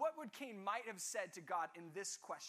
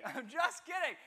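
louder, laughing through I'm just kidding; 4 semitones higher, 9 dB louder than usual. Real, not acted. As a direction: laugh it off to the class.

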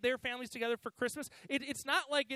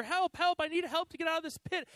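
higher, louder mock cry for help; imitated yell; 6 semitones higher, 4 dB louder than usual. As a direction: act out yelling up out of a pit. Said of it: "he's yelling to the doctor".

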